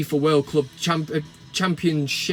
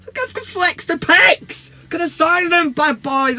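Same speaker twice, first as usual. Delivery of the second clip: boastful; 10 semitones higher, 4 dB louder than usual. Acted. Boasting about his muscles.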